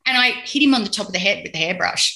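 ordinary talk, nothing marked

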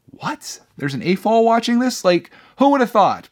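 high-pitched incredulous reaction; mock disbelief. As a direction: act astonished, pitch shooting up. Mock disbelief at older viewers.

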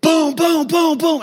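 high, punchy booms; heavy door-banging sound effect; imitated pounding knock. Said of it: "no, it's a boom".

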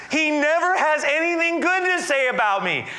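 very high, hammed-up royal grievance; whining complaint. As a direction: act out a put-upon king complaining at high pitch. Ahab's complaint about Micaiah, acted out.

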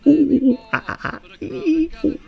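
high-pitched monkey hoots; monkey impression. Monkey answers the locals, high-pitched hooting impression. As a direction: whoop and chatter like a monkey, loud first hoot. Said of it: "My best monkey accent".

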